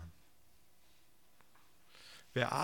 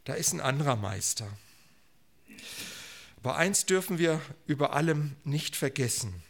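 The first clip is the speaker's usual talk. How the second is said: ordinary talk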